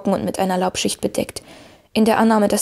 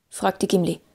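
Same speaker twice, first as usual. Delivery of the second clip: ordinary delivery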